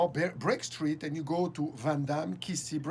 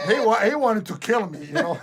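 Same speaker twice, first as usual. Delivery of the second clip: laughing; 3 semitones higher, 9 dB louder than usual. Real, not acted. Laughing while telling.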